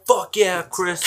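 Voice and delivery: excited cheer. Retold or imitated. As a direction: yell it like a hyped teen at a party. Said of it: "this kid's just like".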